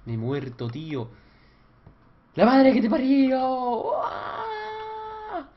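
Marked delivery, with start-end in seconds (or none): 2.3-3.4 loud, high furious curse; furious shout. Real, not acted. Gamer raging at the game after dying. 3.4-5.5 shrill high wail of frustration; high rage scream. Real, not acted. Gamer screaming after his death.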